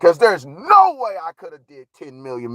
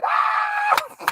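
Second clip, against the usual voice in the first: high yell; 4 dB quieter than usual. Real, not acted. Yells after punchline.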